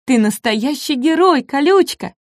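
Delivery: high, delighted little voice; small dinosaur cheering his hero; admiring exclamation. A narrator's reading. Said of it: "воскликнул Шишка".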